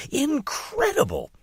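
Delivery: high, swooping and thrilled; gushing excitement. Acted. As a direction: sell it like the best thing ever. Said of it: "as enthusiastic as my mother".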